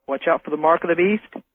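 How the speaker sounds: ordinary talk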